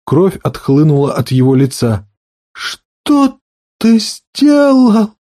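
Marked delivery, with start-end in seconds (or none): 2.5-5.1 very slow, high, broken cry; shocked whisper. A narrator's reading. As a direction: barely get the words out in shock. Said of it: "прошептал он". (потрясённый шёпот)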